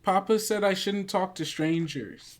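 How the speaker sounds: lightly voiced wary little girl; a cautious kid repeating her papa's rule. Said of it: "you hear on the other side of the door".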